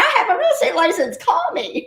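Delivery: high, quick mock sales pitch; chirpy self-promotion. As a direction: pipe it out like an overkeen newbie. Acting out naive Facebook pitch.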